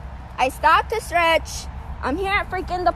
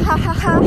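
sarcastic fake laugh; 3 semitones higher, 5 dB louder than usual. Real, not acted.

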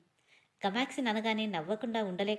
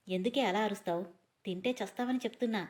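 ordinary delivery, nothing marked

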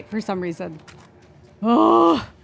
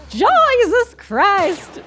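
drawn-out startled cry; 7 semitones higher, 5 dB louder, much slower than usual. Real, not acted.

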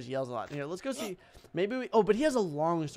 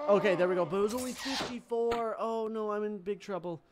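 ordinary delivery

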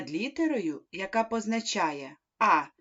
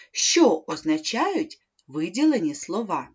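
ordinary talk

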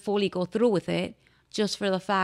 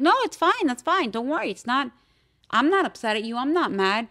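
lightly imitated friend, pitched up with swooping emphasis; higher, singsong mock reassurance; 5 semitones higher than usual. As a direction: brush off an apology in a higher imitated voice. Retold friend brushing off apology.

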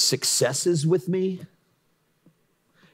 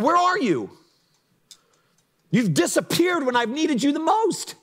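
desperate prayer; 12 semitones higher than usual. Retold or imitated. Recalling his own prayers in hard seasons, raw desperate cry. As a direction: call out to God as if abandoned. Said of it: "that's all I prayed".

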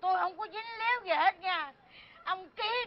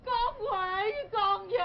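sobbing wail; 2 semitones higher, slower than usual. Acted. Mother wailing over ruined daughter.

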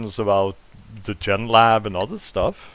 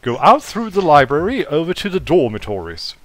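higher, louder posh English butler; crisp directions in an attempted posh English accent; 4 semitones higher, 4 dB louder than usual. Robot butler directing a guest to the dormitories, posh English accent. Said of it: "a posh English accent".

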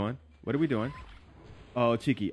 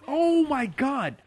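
shocked gasp; 13 semitones higher, 3 dB louder, slower than usual. Real, not acted. Shocked by two enemies.

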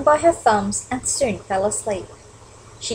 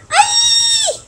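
very high-pitched bird shriek; sudden piercing squeal; 4 dB louder than usual. A narrator's reading. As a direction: shriek in storybook panic. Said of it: "shrieked Flap".

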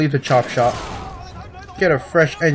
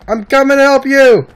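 shout; 9 semitones higher, 5 dB louder than usual. Real, not acted. Rushing in to help ally.

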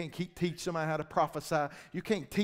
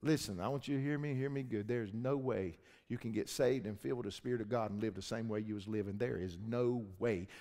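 earnest low preaching; 5 semitones lower than usual. Acted.

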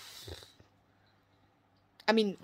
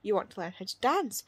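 normal talking voice

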